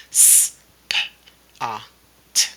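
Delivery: SPOT split into s-, p-, ah, t; sounded out. Shown as a demonstration. Teacher dictating spot for writing, sounded out. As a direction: say s-, p-, ah, t one sound at a time, long gaps. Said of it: "just do it from sounds".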